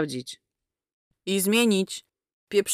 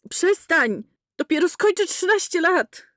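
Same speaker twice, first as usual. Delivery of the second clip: put-on high, outraged child's voice; protest, appalled; high boy's voice crying stop; horrified boy; 9 semitones higher than usual. A narrator's reading.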